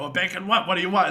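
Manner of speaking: mock a pushy counterman's demand; impatient retold demand. Retold gruff worker badgering kid.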